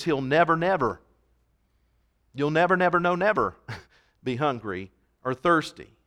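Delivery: each "never" leaned on in a row; repeated "never" pressed harder each time; stress on never. Shown as a demonstration. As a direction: hammer "never, never, no, never". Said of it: "he uses a double negative here".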